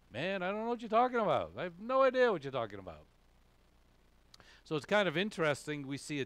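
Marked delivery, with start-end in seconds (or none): 0.1-3.1 raised, louder voice protesting innocence; acted-out indignant denial; emphatic denial. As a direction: deny it insistently, pitched up. Acting out Peter's denial.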